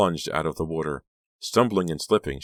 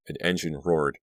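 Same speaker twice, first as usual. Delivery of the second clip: plain reading voice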